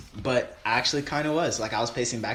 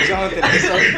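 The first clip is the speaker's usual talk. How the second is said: laughing; 6 semitones higher, 8 dB louder, much faster than usual. Real, not acted. Apologizing while laughing.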